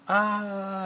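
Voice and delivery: put-on high-pitched storybook boy's yell; squealed "ah" of a mortified kid; acted yell. A narrator's reading. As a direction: cry out high in mock horror. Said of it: "yelled Andrew".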